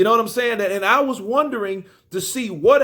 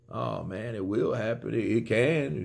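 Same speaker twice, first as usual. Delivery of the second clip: low, soft and flat, no fire at all; quiet, listless mock of a beaten-down fighter; deflated monotone; 6 semitones lower, 7 dB quieter than usual. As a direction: mimic a champ who sounds let down. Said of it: "He sounded like he was kinda down".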